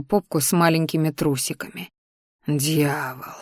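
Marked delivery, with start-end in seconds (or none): 2.5-3.3 hushed, tormented curse; pained moan. A narrator's reading. Man overcome by desire beside the sleeper, pained moan. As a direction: groan it softly under strain. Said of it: "вымученно простонал я".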